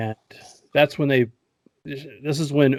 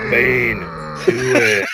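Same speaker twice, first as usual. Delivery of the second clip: loud, drawn-out two-word grunt; hammed-up brute repeating an order; mock dumb-henchman voice; dim henchman Bane; 3 semitones higher, 4 dB louder, slower than usual. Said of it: "That just speaks in two-word sentences".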